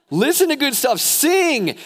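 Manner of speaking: very high, loud rallying cry; punchy full-voice urging; shouted exhortation. Real, not acted. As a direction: shout it to rouse the congregation.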